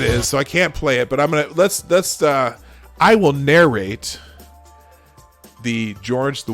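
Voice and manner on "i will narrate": ordinary delivery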